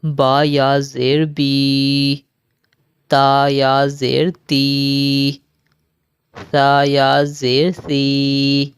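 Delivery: slow, chanted letter-by-letter spelling; Qaida drill recitation. Shown as a demonstration. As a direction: spell each syllable out, then join and stop. (قاعدہ کی ہجے والی قراءت)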